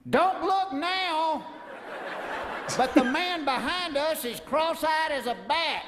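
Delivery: slow, drawn-out grandma aside; a hint of a country grandma telling a secret; grandma whisper-aside. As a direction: lean in like a nosy grandma. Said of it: "and she says to me".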